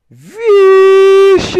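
very high, loud drawn-out squeal; startled shriek. Real, not acted. Gamer spots trouble on screen, startled high shriek. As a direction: shriek in sudden alarm.

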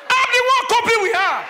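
comic outburst about his wife; exasperated punchline. Real, not acted. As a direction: blurt it out like a fed-up husband.

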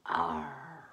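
deep, low growled "Arr"; a low pirate exhale; pirate sigh. Shown as a demonstration. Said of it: "And exhale".

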